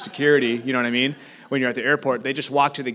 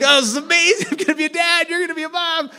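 very high and loud, laughing through joyful tears; crying with joy; 8 dB louder, faster than usual. Retold or imitated. New father telling his wife about the baby, tearful joyful high voice. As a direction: gush it out overwhelmed with happiness. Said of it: "And I was crying".

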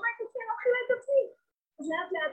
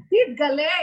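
loud cry; 3 semitones higher, 10 dB louder, slower than usual. Acted. Crying out to God.

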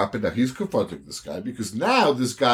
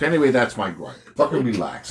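ordinary talk, nothing marked

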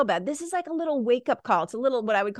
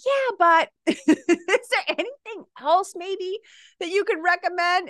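whiny client; 7 semitones higher than usual. Retold or imitated. Coach imitates a client begging for more recommendations, high and wheedling. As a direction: plead for one more tip, half laughing. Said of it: "people will be like".

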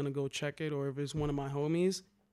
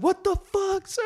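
much higher, louder mock yell at the intruder; retold angry yell; 9 dB louder than usual. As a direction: bark it out like the angry owner. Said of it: "He started yelling at me".

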